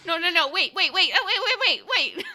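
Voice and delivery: high frantic begging, laughing through it; panicked pleading gnome. Acted. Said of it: "he's like".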